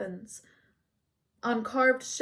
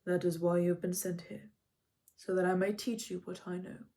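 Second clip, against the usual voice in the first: a touch softer, lightly voiced centaur; gently reassuring teacher; softened; 3 semitones lower, 3 dB quieter than usual. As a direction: let the sternness melt a little. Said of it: "Chiron's stern face softened".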